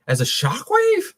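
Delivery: slow question leaping very high at the end; incredulous, high-rising query; puzzled disbelief. Real, not acted. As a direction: question it slowly, voice shooting up in doubt. Puzzled by the viewer's suggestion.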